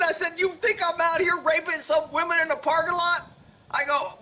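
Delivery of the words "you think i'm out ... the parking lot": high-pitched, incredulous mock outrage; retold indignant challenge. Off-duty cop to suspicious officer, indignant challenge. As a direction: throw the accusation back, voice raised high.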